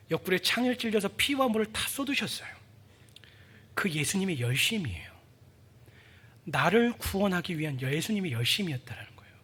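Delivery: hushed whisper of the crucifixion; solemn whispered testimony; reverent whisper. Real, not acted. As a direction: whisper it with awe. Describes the crucifixion.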